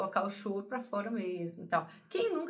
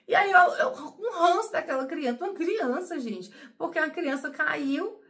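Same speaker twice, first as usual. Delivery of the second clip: laughing; 6 semitones higher, 7 dB louder than usual. Real, not acted. Laughing at her own grudge.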